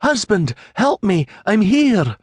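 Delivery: high-pitched cry for help; desperate yell. A narrator's reading. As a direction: call out urgently across the water. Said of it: "she yelled".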